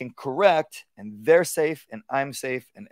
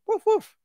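high yappy woof woof; dog bark imitation. Imitating the dog barking at the gate, high. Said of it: "The dog might even go".

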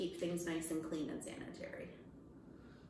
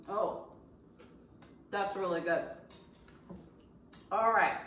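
words muffled by food; mouth full. Real, not acted. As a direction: talk through a mouthful of food. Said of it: "I'm talking with my mouth full".

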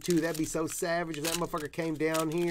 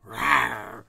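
playful growl; 4 semitones lower, 6 dB louder than usual. Pretend. Growl after autograph pull.

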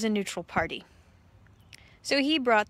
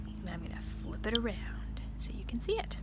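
whispered aside; 9 dB quieter, faster than usual. Real, not acted. Aside while flipping book.

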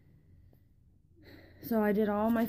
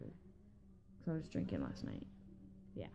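much softer and slow; muttered aside; 20 dB quieter, slower than usual. Real, not acted. Confessing again to viewers, almost inaudible. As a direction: mutter it to yourself. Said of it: "repeated under breath".

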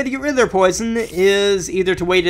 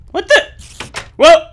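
high, sudden startled yelp; 11 semitones higher, 7 dB louder than usual. Real, not acted. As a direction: jump at the shock. Sudden fall in the game.

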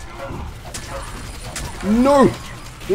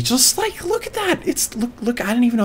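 high-pitched disgust; 10 semitones higher, 4 dB quieter than usual. Real, not acted. Showing disgusting liquid.

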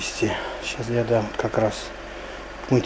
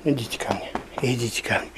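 soft unvoiced whisper; 2 semitones lower, 7 dB quieter than usual. Real, not acted. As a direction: whisper the dogs over gently. Coaxing dogs in the dark.

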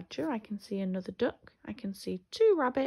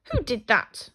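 louder, indignant little squirrel; cross; 3 semitones higher, 11 dB louder than usual. A narrator's reading. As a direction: demand it crossly, mildly acted. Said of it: "the squirrel asked crossly".

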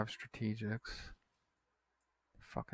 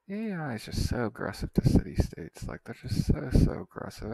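louder, exasperated complaint that builds; annoyed rant; 3 semitones higher, 8 dB louder than usual. Real, not acted. Gamer complaining to viewers about the aggressive AI, annoyed rant. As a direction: vent at the AI, rising irritation. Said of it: "you can tell I'm annoyed right now".

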